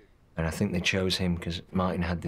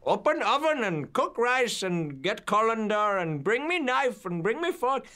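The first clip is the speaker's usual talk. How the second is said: imitated shouted orders in an Indian accent, pitched high; screaming orders. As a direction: rattle off kitchen commands like a bossy chef. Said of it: "like, screaming orders".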